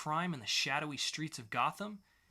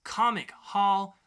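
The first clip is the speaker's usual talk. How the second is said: slow, loud, higher announcer voice; announcer intro; 5 semitones higher, 8 dB louder, slower than usual. Acted. Host opening the weekly poll segment, big slow announcer voice. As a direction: draw each phrase out like a jingle.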